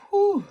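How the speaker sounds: loud, high whew; deep breath out. Real, not acted. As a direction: blow out a big breath to recover. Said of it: "take a deep breath".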